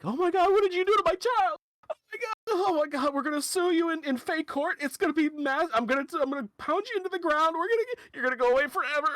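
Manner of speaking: very high, loud, rushed hysterical mom; freaking-out mother. Acted. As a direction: rant in a shrieky panic, threats tumbling out. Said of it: "is freaking out".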